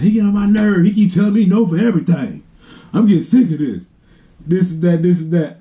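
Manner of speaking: high-voiced fed-up kid ranting; mock tantrum. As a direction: complain shrilly, imitating a brother's fit. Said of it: "just throwing a fit".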